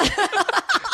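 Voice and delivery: very high squeal of laughter. Retold or imitated. Husband breaking into laughter at wife's confusion, high squeal. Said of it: "And I started laughing".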